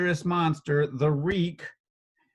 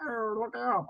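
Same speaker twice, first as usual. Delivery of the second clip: mock Bosk voice; 8 semitones higher, 5 dB quieter than usual. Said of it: "He doesn't talk like that, does he?".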